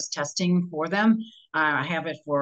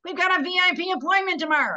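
put-on higher, louder, giddy old man telling his wife; thrilled old man; 10 semitones higher, 4 dB louder than usual. Old customer announcing VIP appointment to wife, thrilled and high. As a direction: imitate the old customer bursting with delight. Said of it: "He's like".

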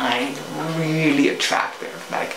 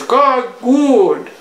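salesman voice; 8 semitones higher, 5 dB louder than usual. Retold or imitated. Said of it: "He says".

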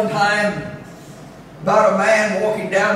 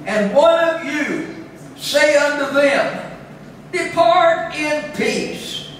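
scripture reading; 4 semitones higher than usual. Acted.

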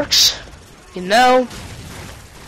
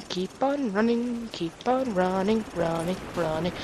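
fading to a murmur; 5 semitones lower, 13 dB quieter than usual. Real, not acted. Running through the map, chant fading to a murmur. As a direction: trail off to almost nothing, chant-like.

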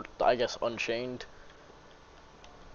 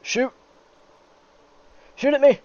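shouting; 11 dB louder than usual. Real, not acted. Yelling at enemy in game.

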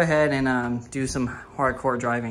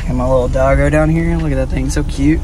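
loud, gushing over the little doggo; excited about dog; 9 dB louder than usual. Real, not acted. Vlogger greeting his little dog, loud and excited.

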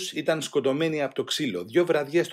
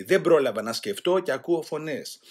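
ordinary delivery, nothing marked

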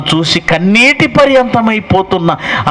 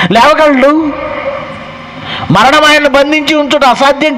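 fiery preaching; 3 semitones higher than usual. Acted. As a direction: drive the question home to the congregation. Preacher rising to full force.

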